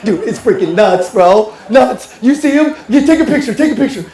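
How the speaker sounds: mock paranoid about his twin; mock crazed. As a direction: build into a hammed-up frenzy. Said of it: "using crazy eyes".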